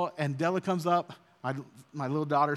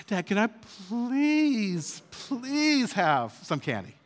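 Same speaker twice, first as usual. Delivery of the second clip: put-on begging child; 5 semitones higher, slower than usual. Daughter begging for candy.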